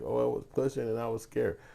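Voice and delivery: high-pitched imitation of a frightened witness; mock scared bystander. Voicing frightened people on the news.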